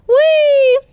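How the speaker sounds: put-on high, loud whee of a kid on a ride; thrilled playground cheer; gleeful squeal. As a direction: squeal with delight as you swoop.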